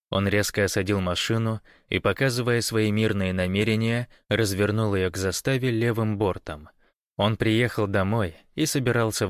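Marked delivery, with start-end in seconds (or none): none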